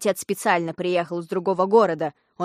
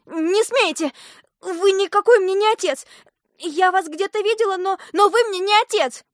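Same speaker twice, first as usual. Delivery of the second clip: very high, loud protest; desperate shouting; 10 semitones higher, 5 dB louder than usual. A narrator's reading. Girl denies the impostor is her father, desperate shouting. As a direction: cry out in rising alarm at the stranger. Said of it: "закричала вдруг Алиса".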